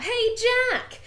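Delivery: high, loud call across a golf course; shout. Acted. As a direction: yell out to get his attention. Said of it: "he hears a shout".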